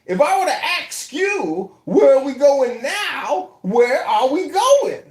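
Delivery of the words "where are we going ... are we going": ordinary talk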